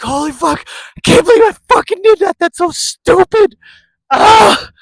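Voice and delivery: very high, loud frantic self-scolding squeal; rising into a high yell of embarrassed glee; shrieking disbelief. Retold or imitated. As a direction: screech it like you can't believe what you just did. Disbelief at own reckless act.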